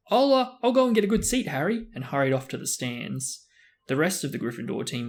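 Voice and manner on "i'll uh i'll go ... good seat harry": high, loud piping call. Acted. Young fan calling after Harry in a high piping voice. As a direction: pipe it up like an eager boy. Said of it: "in a piping voice".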